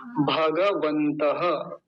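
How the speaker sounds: भगवन्तः very slow with a clear final breathy ह after the visarga; slow careful pronunciation. Shown as a demonstration. Teacher modelling a Sanskrit word for learners, very slow. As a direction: spell out भगवन्तः slowly, sounding the visarga. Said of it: "उससे अगला फिर देखिए".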